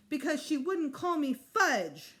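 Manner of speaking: lightly voiced sulky small child; whiny little boy. Fudge complaining to the principal, slow and sulky.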